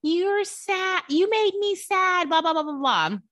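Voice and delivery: put-on high, slow whimpering kid voice; whiny sad child. Father imitating his son crying to mommy. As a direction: mimic a sulky crying boy. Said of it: "he gets so sad and he cries".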